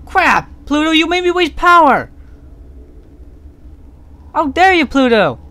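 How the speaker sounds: high, loud furious yell; angry shout. Real, not acted. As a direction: yell at him, outraged. Angry at game character trick.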